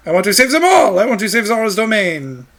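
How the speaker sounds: put-on loud, high French-accented declaration; French-accented rallying cry. Ruto vowing to save her people, French-accented and loud. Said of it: "made Ruto French".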